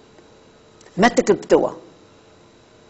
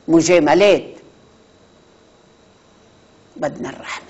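ordinary delivery

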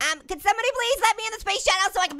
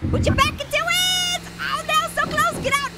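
excited shout; 12 semitones higher than usual. Real, not acted. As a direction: yell it out urging the jump on. Cheering on a frog jump.

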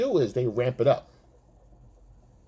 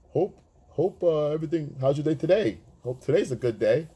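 corny guy message; 5 semitones higher than usual. Retold or imitated. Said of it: "You send another message".

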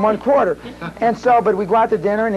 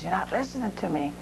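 much softer, cold warning; quiet warning; 11 dB quieter than usual. Retold or imitated. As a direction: drop the voice to warn him. Mob boss warning.

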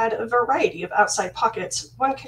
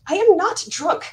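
a high, loud indignant snap; snapping; 9 semitones higher, 5 dB louder than usual. Acted. Princess snaps at Caden who calls her drunk, indignant. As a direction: bite back at the accusation. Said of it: "I snapped".

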